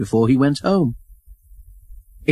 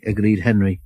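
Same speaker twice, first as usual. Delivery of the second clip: ordinary delivery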